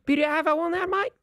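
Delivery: high imitation of an Aussie cafe worker; high put-on Aussie customer-service question; Australian accent. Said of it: "She's Aussie".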